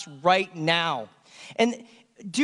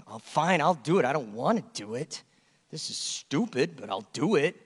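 grudging grumble; 4 semitones lower, 4 dB quieter than usual. Retold or imitated. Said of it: "aren't we the same way?".

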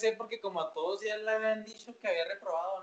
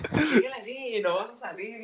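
mother threatening; 5 semitones higher, 4 dB louder than usual. Retold or imitated. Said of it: "mom's threat".

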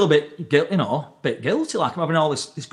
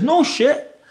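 astonished; 11 semitones higher than usual. Retold or imitated. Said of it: "he says, he says".

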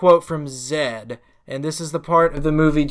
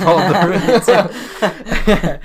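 laughing; 4 semitones higher, 3 dB louder, much slower than usual. Real, not acted. Repeating friend's joke.

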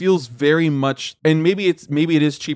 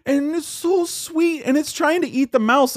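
gushing; 10 semitones higher than usual. Real, not acted. Gushing over cute movie cat.

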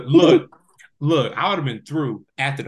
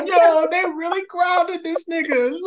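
laughing; 4 dB louder than usual. Real, not acted. Reacting with laughter.

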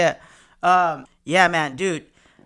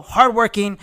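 normal talking voice

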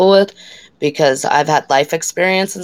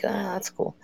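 flat reply; 3 semitones higher, 12 dB quieter than usual. Retold or imitated. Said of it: "But I'm like".

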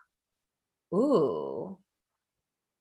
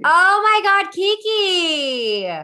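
high, loud excited cry; excited squeal; 12 semitones higher, 6 dB louder, much slower than usual. Real, not acted. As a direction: squeal with delight. Excited about going to LA.

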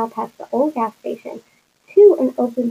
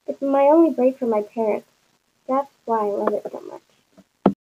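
ordinary delivery

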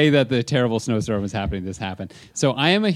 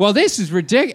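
high, louder mock complaint; loud Barb; 8 semitones higher, 5 dB louder than usual. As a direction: grumble loudly as the fussy tourist. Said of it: "so loud all the time".

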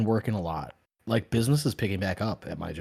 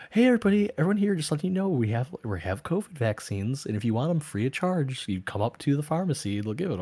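higher and louder, mock store PA announcement; store intercom announcer; 9 semitones higher, 7 dB louder than usual. Live dude on the Walmart intercom offering free vaccines, mock announcer voice. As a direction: call it out like a cheery store announcer. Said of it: "No, it was some, like, live dude".

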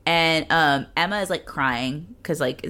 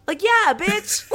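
sassy retort; 10 semitones higher, much slower than usual. Pretend. Sarcastic retort as Emma.

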